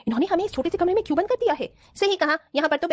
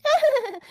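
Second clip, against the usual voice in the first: giggle; 10 semitones higher than usual. Acted. Bride giggling on the phone.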